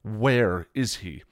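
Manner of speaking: loud sarcastic line, then a slow demand; scowling sarcasm. A narrator's reading. Buffy scowls at Willow, sarcastic and demanding. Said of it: "Buffy scowled".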